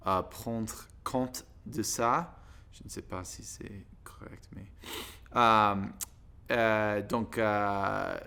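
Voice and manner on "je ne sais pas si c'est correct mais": near-whispered unsure aside; à voix basse. Real, not acted. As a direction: drop to a whisper, doubting yourself. Unsure aside about own French. (chuchoté, hésitant)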